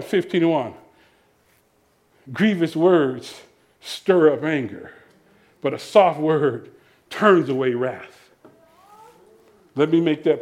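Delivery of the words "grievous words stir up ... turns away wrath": slow, low, measured verse reading; scripture recitation. Real, not acted. Preacher reciting a proverb to quarrelling couples, slow and grave. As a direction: let each phrase of the proverb settle.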